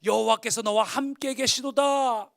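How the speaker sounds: loud, slow, high heavenly announcement; angel's proclamation. Acted. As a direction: proclaim it like a messenger from heaven, drawn out. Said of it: "여호와의 사자가 기드온에게 나타나 이르되". (천사의 우렁찬 선포)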